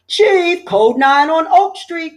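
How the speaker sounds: high, loud urgent call. A narrator's reading. Firefighter calling the chief to an emergency, loud call. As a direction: call the alarm across the firehouse. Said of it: "called a firefighter".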